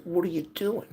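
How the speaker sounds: high, loud, disbelieving; exasperated question. Retold or imitated. Son catching mother playing cards, loud exasperated question. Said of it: "I said".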